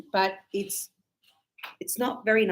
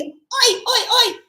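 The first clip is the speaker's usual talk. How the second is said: high, loud repeated oi; calling oi; 12 semitones higher, 5 dB louder, much slower than usual. Shown as a demonstration. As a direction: shout oi to make them turn.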